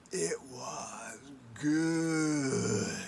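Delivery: very slow, low relish; low slow dreamy relish; slow savoring. Acted. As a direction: linger over each word. Raven savoring slowly.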